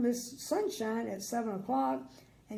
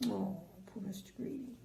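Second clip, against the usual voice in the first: soft unvoiced pity; whisper; 8 dB quieter than usual. Real, not acted. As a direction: whisper it with sympathy. Whispered aside to listeners.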